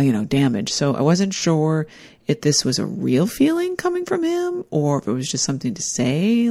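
ordinary talk

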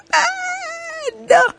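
mad squeaked high and thin; squeaky mad. Real, not acted. Venting to listeners about her husband, squeaked mad. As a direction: squeeze the word out in a high squeak. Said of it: "I was so damn".

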